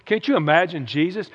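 usual speaking voice

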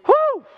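high, loud exultant whoop; preacher whooping to fire up the congregation; joyful whoop. Real, not acted. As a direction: let out a celebratory shout.

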